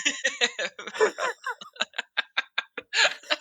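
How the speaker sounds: long, high burst of laughter; hearty laugh. Real, not acted. Guest reacts to teasing about British players, hearty laugh.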